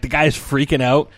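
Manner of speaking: usual speaking voice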